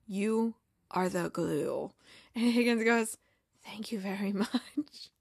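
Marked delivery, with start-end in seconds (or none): 0.1-1.9 low, hushed, solemn mock-prophet; grand soft Zava voice. Star player solemnly greeting his new manager, low and hushed. 3.6-5.1 breathy, overcome little whisper; mock tearful whisper. Director thanking a star player, whispered and overcome.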